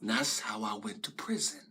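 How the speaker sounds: low, near-whispered conclusion; hushed resignation. Acted. Ending the story of his imprisonment, hushed resignation. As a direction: let the voice fall away to a whisper.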